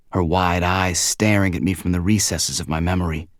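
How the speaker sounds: ordinary delivery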